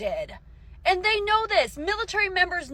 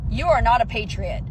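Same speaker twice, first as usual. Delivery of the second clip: usual speaking voice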